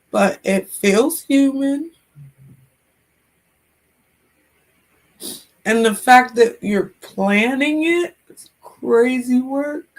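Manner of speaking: slow, voice breaking with tears; fighting back tears as she speaks, slowly; tearful. Real, not acted. Talking to chat about putting her dog down, slow and tearful.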